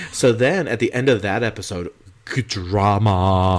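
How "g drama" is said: drama stretched out long; slow, hammed-up suspense; mock dramatic. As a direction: draw the word out theatrically. Drawn-out dramatic announcement of the twist.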